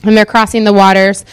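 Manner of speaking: usual speaking voice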